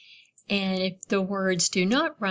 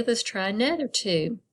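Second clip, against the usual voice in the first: ordinary talk, nothing marked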